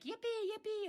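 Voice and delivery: put-on high, squeaky gleeful kid; bouncing kid. Ranter mimics music bouncing in his ear, high kiddie squeal. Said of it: "like a kid on a bouncy house".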